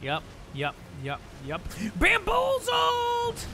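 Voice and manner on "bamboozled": very high, loud stretched shout; drawn-out yell. Real, not acted. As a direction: yell it long on a high held note. Yells in the middle of gameplay.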